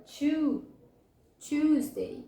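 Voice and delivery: "tu" alone, then "Tuesday" with stress on CHOOZ; stressed first syllable. Shown as a demonstration. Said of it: "Sílaba tônica".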